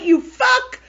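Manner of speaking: high, loud hurled insult, played up; mock raging. Re-enacting the outburst at her sister, mock raging. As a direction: hurl it in pretend fury. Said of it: "yesterday I had a raging moment".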